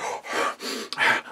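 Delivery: mock gasp. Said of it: "Angelo Mosca would be like".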